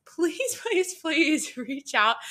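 high, drawn-out begging; slow, high-pitched plea; playful pleading. Real, not acted. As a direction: beg with a laugh, stretching it out. Begs lost acquaintance to get in touch.

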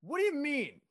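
high, loud burst of disbelief; incredulous. Acted. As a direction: demand an explanation in comic bafflement. Incredulous reaction to customer's remark.

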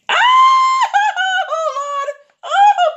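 high, loud comic wail; screaming. Acted. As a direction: shriek in hammed-up terror. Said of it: "I'm so sorry for screaming".

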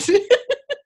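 a high, bright laugh. Real, not acted. After teasing the skeptic, a high laugh.

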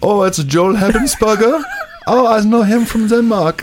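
high, slow put-on Danish voice; Danish character. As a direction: play a high-pitched Dane recognising someone.

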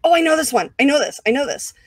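high, bouncing eagerness; itching to answer the quiz; keen student dying to be called on; eager. Retold or imitated. Eager quiz answer in front row.

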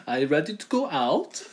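lilting mock Swedish accent, rising at the end; fumbled German accent that comes out Swedish; Swedish-sounding accent. Trying German for co-host, accent comes out Swedish. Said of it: "There you go, Swedish".

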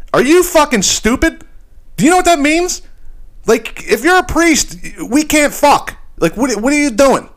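high-pitched, exasperated disbelief; incredulous girlfriend. Retold or imitated. Girlfriend scolds him for wanting priesthood, incredulous.

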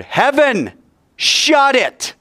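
high, booming order to hush; thundered command. Acted. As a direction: thunder it out as God silencing all heaven. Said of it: "he thundered".

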